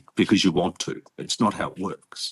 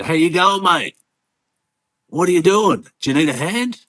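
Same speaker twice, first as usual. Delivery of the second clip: high, loud, broad Aussie greeting; full Aussie accent; 9 semitones higher, 7 dB louder than usual. Retold or imitated. Man at the mosque offering a newcomer help, full Aussie accent. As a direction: imitate a friendly local in thick Aussie, pitched up and loud. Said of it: "Full Aussie accent".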